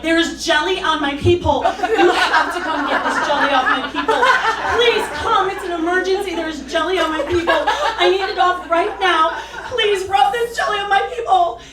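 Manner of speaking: loud, high, panicked begging; loud, high frantic call to security; frantic pleading. Retold or imitated. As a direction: plead like it's an emergency.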